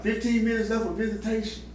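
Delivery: imitated guard calling time, pitched well up; high announcing voice, retold; guard's announcement. Prison guard to visitors, called-out time warning.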